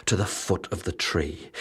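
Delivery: plain reading voice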